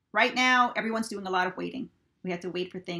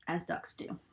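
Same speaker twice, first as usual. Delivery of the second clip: usual speaking voice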